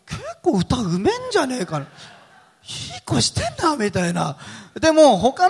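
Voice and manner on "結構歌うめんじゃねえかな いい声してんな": very high, put-on self-impressed voice; self-admiring. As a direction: play someone delighted with their own singing, pitched way up. Acts self-admiring bathroom singer.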